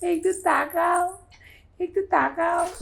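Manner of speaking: high, loud sing-song coaxing; slow, high-pitched plea to a little one. Real, not acted. Mum asks baby to look, high coaxing.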